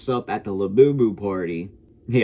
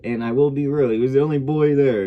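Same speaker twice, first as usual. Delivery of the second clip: normal talking voice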